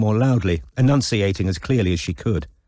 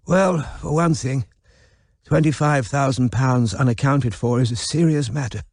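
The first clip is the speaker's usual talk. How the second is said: put-on frail old man; 4 semitones higher than usual.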